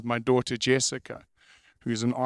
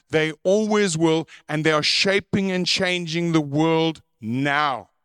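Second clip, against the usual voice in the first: preaching; 3 semitones higher, slower than usual. Acted. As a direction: preach it with rising force. Preaching at full force.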